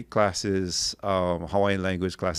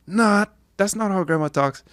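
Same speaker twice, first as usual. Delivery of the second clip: high, louder mock retort; retorting to the teacher; 11 semitones higher, 4 dB louder than usual. Student correcting the teacher in class, high cocky retort. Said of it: "and I go".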